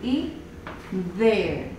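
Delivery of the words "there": "there" with voiced th, falling; English TH sound. Shown as a demonstration. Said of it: "Como en su ejemplo". ("there" con th sonora, entonación descendente)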